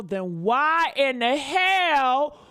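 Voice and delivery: very slow, high, loud drawn-out yell; outraged yell. Real, not acted. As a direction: stretch each word out in outrage. Exploding in outrage.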